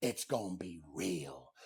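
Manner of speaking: dead serious. Real, not acted. Serious vow about air hockey.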